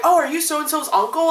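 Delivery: high, put-on innocent question; naive victim at door. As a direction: mimic a gullible friend at the door. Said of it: "they answer the door like".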